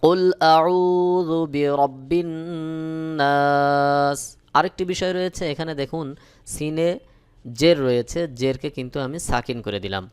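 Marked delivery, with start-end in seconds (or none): 0.0-4.2 slow, chanted, louder with held madd; নাস held three alifs with ghunnah; correct tajweed recitation. Shown as a demonstration. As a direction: recite it properly like a qari. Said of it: "সহি উচ্চারণ হলো".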